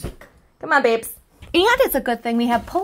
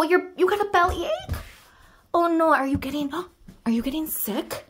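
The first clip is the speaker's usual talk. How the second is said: ordinary talk, nothing marked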